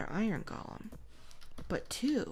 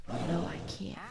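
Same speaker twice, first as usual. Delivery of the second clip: usual speaking voice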